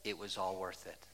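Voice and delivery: low hush of final contentment; quiet, low, reverent; soft and low. Pretend. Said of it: "we're all gonna say".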